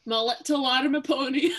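put-on My little armored pony in Jack's accent. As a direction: say it the way Jack talks. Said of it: "in Jack's accent?".

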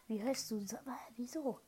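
ordinary talk, nothing marked

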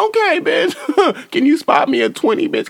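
squeaky voice through laughter; giggly high-pitched begging; high laughing tease. Retold or imitated. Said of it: "was like".

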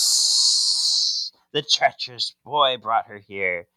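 opens on a long hiss, then a snake voice. Retold or imitated. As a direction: hiss it like a treacherous serpent. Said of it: "And the snake goes".

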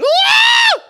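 very high, loud shriek; hammed-up terrified scream; mock shriek. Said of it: "that's how I imagine that shriek".

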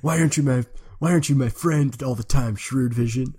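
put-on high, needy friend voice; Foggy Nelson. Law partner complaining to Shrewd Vision, in impression. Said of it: "that was my Foggy Nelson impression".

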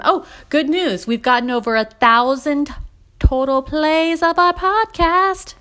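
high, slow, drawn-out good news; excited announcement. Real, not acted. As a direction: proudly tell listeners. Announcing podcast milestone.

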